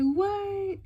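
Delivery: high, loud disbelieving question; astonished. Retold or imitated. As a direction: imitate a man stunned by news. Husband hears the pregnancy news.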